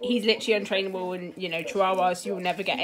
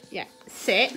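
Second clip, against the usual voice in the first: ordinary talk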